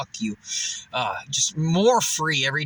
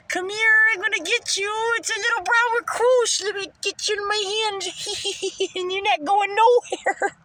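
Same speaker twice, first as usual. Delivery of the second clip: high sing-song menacing voice to the spider; playful creepy voice; 13 semitones higher than usual. Acted. Catching a brown recluse, high playful menace. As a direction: tease the spider like a cartoon villain.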